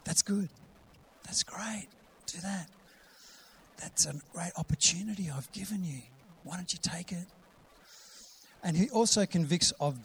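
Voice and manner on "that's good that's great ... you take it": soft, half-whispered approval; hushed, encouraging inner voice; gentle encouragement. Acted. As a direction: quietly coax them toward it. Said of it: "He'll say".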